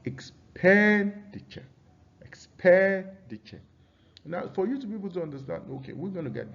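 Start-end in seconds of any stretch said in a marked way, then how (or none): 0.0-3.6 "expenditure" with PEN high, long and punched; stress on third-last syllable. Shown as a demonstration. Teacher demonstrating stress to students, PEN drawn out in "expenditure". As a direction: stretch PEN in "expenditure", high, then drop away. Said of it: "ends on a third syllable".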